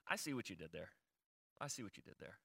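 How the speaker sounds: low, very soft aside to God; hushed, low-pitched murmur; quiet acknowledgement. Retold or imitated. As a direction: drop right down, as if privately conceding. Quiet aside acted toward God.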